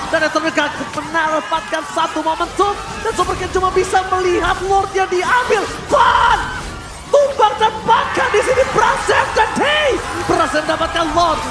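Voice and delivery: high, loud hype casting call; esports caster. Real, not acted. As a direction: scream the Lord steal like a caster. Live Lord steal play-by-play.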